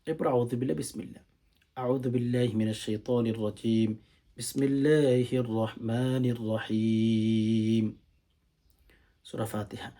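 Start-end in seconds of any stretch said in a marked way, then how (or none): 1.8-7.9 drawn-out, chanted Arabic recitation; Quranic recitation. Shown as a demonstration. Teacher demonstrating the prayer opening, slow chanted recitation. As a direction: chant it slowly, stretching every vowel.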